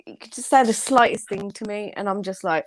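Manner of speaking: normal talking voice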